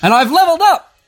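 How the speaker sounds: high, loud whoop of triumph; excited. Real, not acted. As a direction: cheer like you just won.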